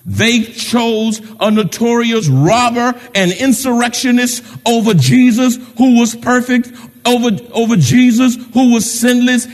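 loud, hammering preacher cadence; preaching at full force. Acted. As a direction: drive each phrase home.

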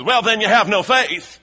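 high, booming mock Southern preacher; loud Southern voice. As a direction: belt it out in a put-on Southern accent. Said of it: "in a kind of loud southern voice".

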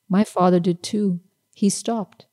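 ordinary delivery, nothing marked

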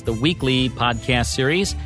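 ordinary delivery

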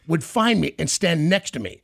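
normal talking voice

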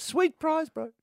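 high mock Kiwi accent; imitating a Kiwi bloke, high; New Zealand accent. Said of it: "mocking Kiwi accent".

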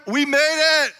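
loud, high cheer of relief; triumphant shout. Retold or imitated. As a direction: celebrate arriving at last. Triumphant end of road trip.

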